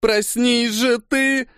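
high, slow hysterical cry. A narrator's reading. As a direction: plead hysterically, stretched and high. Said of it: "в истерике ударила".

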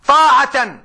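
طاعة punched out loud and high; stressed word. Real, not acted. Teacher to girls, stressing طاعة loud and high. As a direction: land hard on طاعة as the key word.

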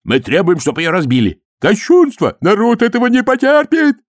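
high, loud crowd protest; outraged demands. A narrator's reading. Priests demand the golem be destroyed, outraged. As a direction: cry out in righteous indignation.

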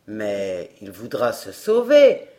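gruff showman. A narrator's reading. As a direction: growl it like a hoarse showman.

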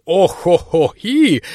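slow, high granny grunts; old woman groaning. Acted. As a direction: strain and groan like an old crone. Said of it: "кряхтение". (старческое кряхтение)

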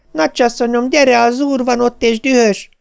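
high, urgent maid's call; alarm cry. A narrator's reading. Maid warns the mistress that the master is angry, crying alarm. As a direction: cry a warning on a very high pitch. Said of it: "Hallottam vészjelző kiáltását".

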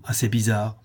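usual speaking voice

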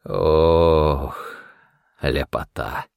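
long, low, blissful sigh of pleasure; drawn-out murmur of bliss. A narrator's reading. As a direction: savour it slowly and contentedly. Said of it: "блаженно зажмурился".

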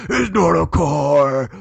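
slow, loud gravelly Batman growl; disguised deep voice. Retold or imitated. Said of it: "his voice was disguised".

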